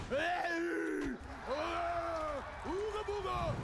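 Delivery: hammed-up caveman hollering at a woman; caveman catcall. Comic mocking crude catcalls with a caveman shout. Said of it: "I'm not talking about".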